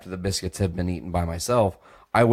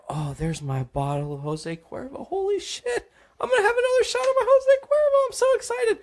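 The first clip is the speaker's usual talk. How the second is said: much higher, fast, giddy squeal; high drunk voice. Retold or imitated. Spotting his tequila bottle again in a giddy high squeal. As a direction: gush like a thrilled drunk kid. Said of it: "I can't do the high voice".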